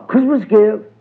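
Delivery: high, excited-sounding holler. A narrator's reading. Uncle Remus shows how he will greet the household, hollering high. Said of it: "and holler".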